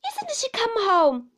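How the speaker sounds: high, loud rueful wail; child's rueful cry, falling away. A narrator's reading.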